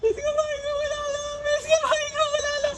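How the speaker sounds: very high, slow mock wail; mocking mimicry. Man imitating beaten woman's plea for brother, mocking mimicry. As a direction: taunt in a squeaky fake-crying whine.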